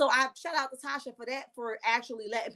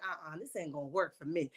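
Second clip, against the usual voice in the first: muted disapproval; 8 semitones lower, 8 dB quieter than usual. Retold or imitated. Voicing her private disapproval.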